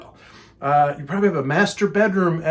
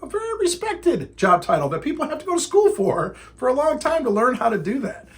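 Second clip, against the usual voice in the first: raised-pitch protest; indignant defence; 5 semitones higher than usual. Retold or imitated. Trainer defending his zookeeper seminar to a critic, indignant. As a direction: argue back insistently, voice pushed higher.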